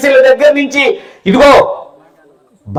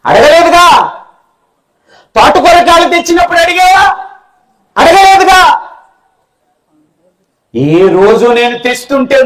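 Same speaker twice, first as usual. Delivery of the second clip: angry son; 6 semitones higher than usual. Acted. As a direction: hurl the blame back at her. Grown son accusing his mother.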